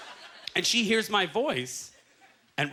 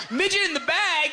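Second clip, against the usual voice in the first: high, louder and laughing in disbelief; incredulous; 11 semitones higher, 4 dB louder than usual. Retold or imitated. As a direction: read the sign out in laughing disbelief. Reading the sign in disbelief.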